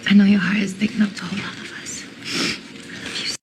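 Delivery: slow, unvoiced reassurance; tender whisper. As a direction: hush it lovingly.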